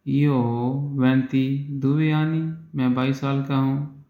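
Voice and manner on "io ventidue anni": slow model phrase. Shown as a demonstration. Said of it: "teacher models Italian slowly".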